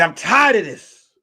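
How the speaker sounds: acted high, loud exasperation; dramatic cry of frustration; fed-up outburst. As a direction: burst out as the worn-out detective. Detective refuses one last case.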